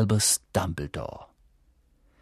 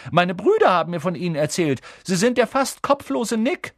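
fast, high, loud excited chatter; eager boy; 9 semitones higher, 6 dB louder than usual. A narrator's reading. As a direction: rush it out eagerly. Ron continues excitedly. (aufgeregt und schnell)

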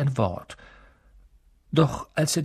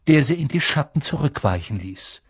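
plain reading voice

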